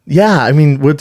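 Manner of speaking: ordinary delivery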